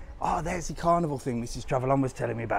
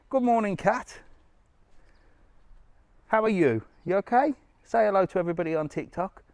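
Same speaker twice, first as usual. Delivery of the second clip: high sing-song voice for the cat; talking to a cat; 8 semitones higher, 3 dB louder than usual. Real, not acted. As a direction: coo at the cat like a pet.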